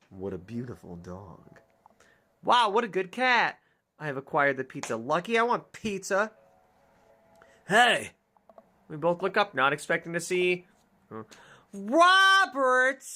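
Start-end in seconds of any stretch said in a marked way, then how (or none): 0.1-1.6 low muttered aside. Acted. Muted repeat far. 7.7-8.1 loud hey across the dark; calling out. Acted. Stranger hails the two in the woods, loud call. Character calls out. 11.7-13.0 high loud startled name; surprised cry. Acted. Player sees Robert appear, surprised cry.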